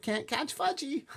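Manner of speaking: very high, drawn-out kiddie taunt; playful holler. A narrator's reading. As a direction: crow it in a little boy's sing-song. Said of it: "he hollered".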